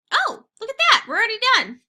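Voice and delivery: high, loud happy surprise; surprised it's over already; pleasantly surprised. Real, not acted. Surprised the lecture ended early.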